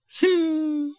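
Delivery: very high sustained whine; long high insect zing; mosquito zing. A narrator's reading. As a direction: imitate a mosquito's thin flight noise. Imitated mosquito zipping away.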